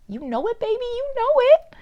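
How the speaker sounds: high-pitched, sing-out cheer; playful hype. Pretend. Reader assures listener their love is deserving, in a playful high squeal. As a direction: jump way up high to egg the listener on.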